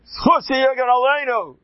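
high, loud ringing invocation; fervent blessing. Real, not acted. Rabbi invoking Hirsch's merit before his congregation, high and loud. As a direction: cry it out with reverence.

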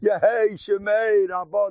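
a lightly voiced devout rabbi answering Amen; imitating fervent response. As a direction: call out the Amen with his whole heart. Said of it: "the way he said".

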